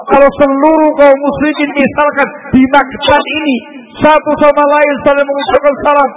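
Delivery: high, loud visionary sermon; fervent preaching. Acted. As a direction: paint the vision with passion. Preacher at full force.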